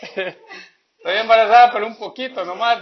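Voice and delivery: put-on silly girl's voice, laughing through the words; daughter's voice. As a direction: ham up the daughter admitting it, laughing.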